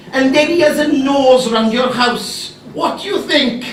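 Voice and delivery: lightly voiced persuading caller; pushy caller. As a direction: sell the idea hard, voice up high. Caller pitching show on phone.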